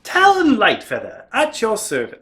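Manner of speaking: bow and introduce yourself in light British; British accent. Pretend. Toy bird figure introducing himself, light British accent. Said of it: "I don't know why I made him British".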